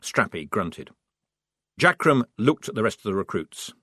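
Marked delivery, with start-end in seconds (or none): none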